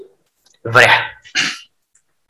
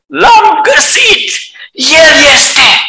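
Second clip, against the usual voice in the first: high, loud joyful shout; a child's triumphant cry; 7 semitones higher, much slower than usual. Acted. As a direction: yell it out in discovery. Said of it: "strigă tare".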